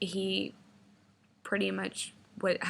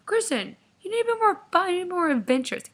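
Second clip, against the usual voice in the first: high, loud, whiny preachy voice; mock his insistent scolding; imitated boyfriend lecturing; 11 semitones higher, 6 dB louder, faster than usual. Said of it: "Gives me a fucking lecture".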